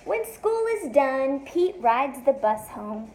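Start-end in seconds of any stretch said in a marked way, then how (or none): none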